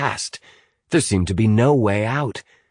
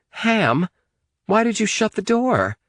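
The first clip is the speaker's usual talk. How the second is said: higher accusing question; 14 semitones higher than usual. A narrator's reading. Amy accusing Hamilton of shutting the door, sharply high. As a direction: pin the blame on him. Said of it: "Amy said accusingly".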